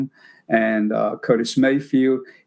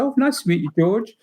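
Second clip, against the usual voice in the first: high, polite British greeting; British accent; 9 semitones higher than usual. Retold or imitated. Young interviewer meeting George, high British voice. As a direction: imitate your younger, very British self. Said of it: "In my very then British accent".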